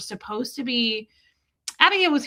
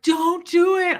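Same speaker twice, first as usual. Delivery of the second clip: high, louder tearful-style begging, retold; retold bawling plea; 8 semitones higher, 5 dB louder, slower than usual. Daughter begging dad not to declaw cat, retold bawling plea. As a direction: imitate your younger self pleading in tears. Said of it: "I was bawling".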